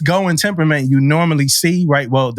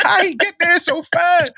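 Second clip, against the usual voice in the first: amazed; 13 semitones higher than usual. Retold or imitated. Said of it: "I'm like".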